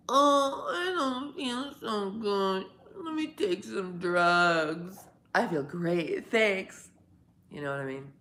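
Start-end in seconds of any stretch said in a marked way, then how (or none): 0.1-6.9 loud, hammed-up sick ad voice, slow at first; cold commercial actor. Pretending to star in a cold medicine commercial. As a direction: play the sniffly patient in a cold ad. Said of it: "could probably get a cold commercial".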